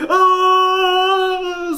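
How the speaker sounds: long, loud, high shriek; horrified scream. A narrator's reading. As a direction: answer with a full-voiced scream. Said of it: "screamed Raj".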